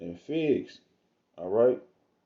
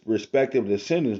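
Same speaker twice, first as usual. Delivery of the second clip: ordinary talk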